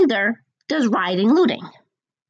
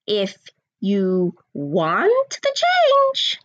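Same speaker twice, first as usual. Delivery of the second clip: drawn out very slowly; slow emphasis; 3 semitones lower, much slower than usual. Shown as a demonstration. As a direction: stretch every word out. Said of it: "So I'll say it again".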